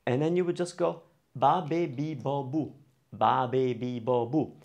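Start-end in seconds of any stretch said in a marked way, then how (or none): 1.3-4.5 "ba, be, bi, bo, bu" in an even chain; open-vowel syllable drill. Shown as a demonstration. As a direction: recite "ba, be, bi, bo, bu" keeping each vowel open. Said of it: "have all the vowels open".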